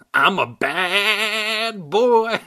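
very high, very slow, laughing puppet voice; cackling bad boy dummy. Dummy bragging to Brittany with a high cackle. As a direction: stretch it out, gleeful and wicked.

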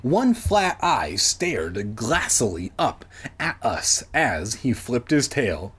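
plain reading voice

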